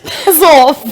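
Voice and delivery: high British rebuff through laughter; giggly mock British; British slang. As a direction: echo the British insult, laughing. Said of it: "That's what they say".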